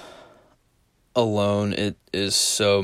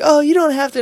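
put-on girl's voice; 7 dB louder than usual. Girl voiced in story.

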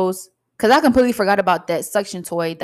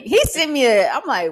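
ordinary talk, nothing marked

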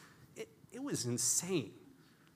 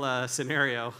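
ordinary talk, nothing marked

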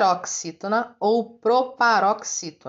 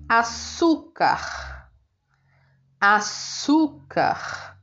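açúcar very slow, çú strongest; drawn-out açúcar with weight on çú; stress on ÇÚ; 3 semitones higher, much slower than usual. Shown as a demonstration. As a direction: stretch out açúcar, leaning on the middle syllable. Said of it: "C cedilha U também é o mais".

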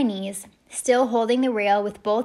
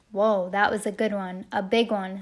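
usual speaking voice

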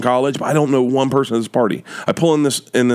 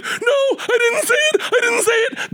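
very high, acted panic cry; panicked denial; slower than usual. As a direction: plead innocence in a frantic, squeaky shout.

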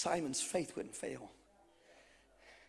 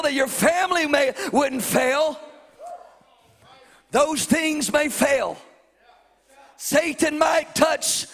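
loud, slow, climbing preacher's cry; fiery preaching; 11 semitones higher, 14 dB louder, slower than usual. Acted. Preacher rallying the congregation, loud and defiant. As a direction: build it up and defy Satan.

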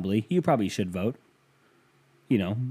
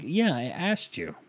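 mock exasperated comeback; 4 semitones higher, slower than usual. Exasperated comeback in acted quarrel.

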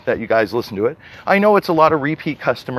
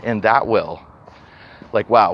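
normal talking voice

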